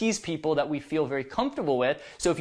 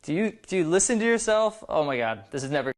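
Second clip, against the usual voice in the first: playful tease; 3 semitones lower, faster than usual. Shown as a demonstration. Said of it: "It should be like".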